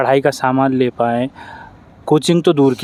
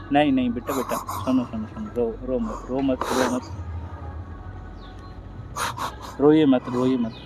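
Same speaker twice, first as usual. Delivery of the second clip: much softer, gentle hushing to calm her tears; 11 dB quieter than usual. Real, not acted. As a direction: hush her tenderly, over and over. Comforting crying girl. (रोती बेटी को धीमी, कोमल आवाज़ में चुप कराना)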